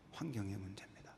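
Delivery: whispered aside. Real, not acted. Preacher hushing to his congregation about the root problem, whispering. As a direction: drop it to a hushed breath. Quiet aside after lament.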